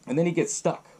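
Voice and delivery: ordinary delivery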